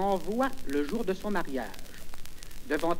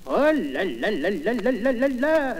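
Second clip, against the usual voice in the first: rolling, wavering string of "là là là"; exclamation comique; 4 semitones higher than usual. Acted. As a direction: roll it out in one long wobbling marvel. Conteur s'exclame sur l'effet. (exclamation chantante et amusée)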